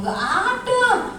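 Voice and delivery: "ada" drawn out on a high pitch; stretched ADA. Real, not acted. Lecturer insists on punctuality, stretched high "ada". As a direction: swoop up high and hold "ada". Emphatic denial of any lateness.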